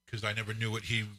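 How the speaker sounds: ordinary talk, nothing marked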